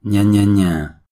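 mocking taunt. Mocking the officer.

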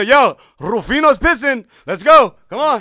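loud, slow call to the others; rowdy neighbour yelling; mock call-out. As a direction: holler it across the block like a nosy neighbour. Neighbours calling out about Rufino.

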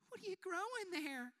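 high, thin mock-curious voice; nosy acquaintance. Acquaintance asking about the mustache, high and teasing. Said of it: "come up and be like".

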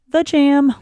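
"the jam" punched high and loud; stress on jam. Real, not acted. Emphatic slang praise.

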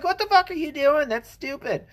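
wife's retort. Retold or imitated. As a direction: voice the wife snapping at the shooter. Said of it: "The woman's like".

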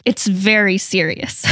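ordinary talk, nothing marked

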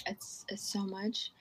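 very soft, slow and halting; hushed, hesitant admission; overwhelmed. Real, not acted. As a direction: barely get it out, taken aback by the question. Overwhelmed by the legacy question.

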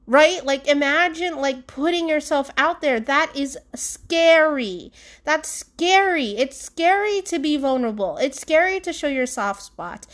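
ordinary talk

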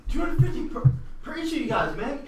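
high-pitched, eager little guy; keen, high character voice; excited gnome. Acted. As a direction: play an overexcited gnome. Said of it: "I'm just so excited".

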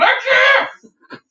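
high, strained protest yell; retold yelling kid. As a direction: act out a kid who won't stop yelling. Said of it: "they'd stop yelling".

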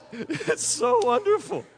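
high, laughing, teary delight; a laughing, tearful sigh of joy; laughing through words. Real, not acted. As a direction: laugh it out, slow. Laughing after mock wail.

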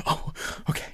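very soft, voiceless mock whimper; small teary murmur. Home alone crying at the computer, imitated quiet whimper. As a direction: act quietly overcome, almost no voice.

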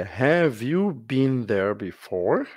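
slow teacher demo. Shown as a demonstration. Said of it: "a frase é".

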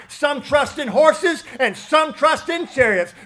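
loud, soaring preacher's cry; preaching at full force. Acted. Pastor preaching to the church, loud and soaring. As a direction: preach it hard to the congregation.

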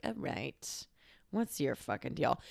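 low muttered under the breath; dropped to a near murmur, unimpressed; muttered hostile aside. Retold or imitated. Muttering about the pushy girl at the bar.

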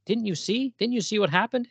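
high-pitched, worked-up pleading; agitated Abel. Acted. Abel pleading with his brother Cain, agitated and high-pitched.

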